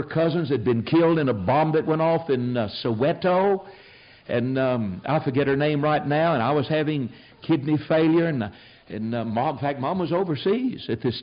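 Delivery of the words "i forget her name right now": ordinary talk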